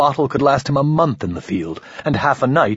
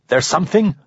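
demanding; 8 semitones higher than usual. Acted. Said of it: "Ramon demanded".

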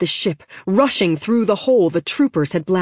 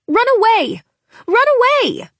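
high, loud scare-off shout; yelling; 5 dB louder than usual. Acted. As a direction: yell it to sound braver than you feel. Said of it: "Lena yelled, trying to sound both braver and scarier".

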